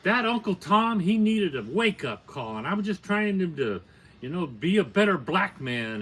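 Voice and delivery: loud, high-pitched angry outburst; going off. Retold or imitated. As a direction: imitate a man loudly losing his temper. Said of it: "he just went off".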